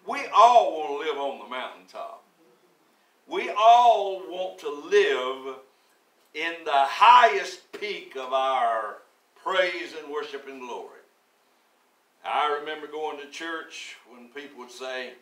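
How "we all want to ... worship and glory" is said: much louder, slow preaching. Acted. Pastor crying out to the congregation, much louder and slower. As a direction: lift the church with full voice.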